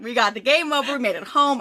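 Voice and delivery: plain reading voice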